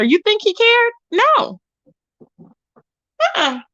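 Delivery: high, sassy rhetorical question then flat no; mock the very idea; emphatic rhetorical. Real, not acted.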